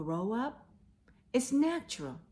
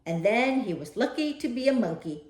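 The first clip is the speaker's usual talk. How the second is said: story narration; 3 semitones higher, 7 dB louder than usual. Acted. Reading Curious George to boys and girls, animated storytelling voice. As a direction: read it like a children's picture book, with big swings. Reads picture book aloud.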